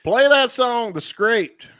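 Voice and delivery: high, loud joke song request; mock heckler shout. Fan heckling the band for a song, mock shouting. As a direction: call it out like a pretend concert heckler.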